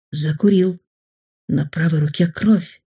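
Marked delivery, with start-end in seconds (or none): none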